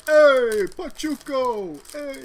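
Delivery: high loud drawn-out call; mock flamboyant hey; movie quote call. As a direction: call out like the dance scene. Quoting dance scene call.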